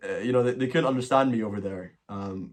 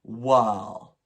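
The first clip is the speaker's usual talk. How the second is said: mock posh "well" stretched towards "wall"; a drifty, distant posh "Wow... well, well"; posh English "well"; much slower than usual. Scottish vlogger imitating her flatmate's posh "well". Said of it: "She would say".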